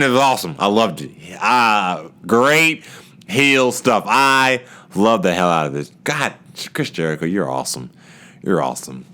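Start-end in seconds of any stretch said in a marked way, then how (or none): none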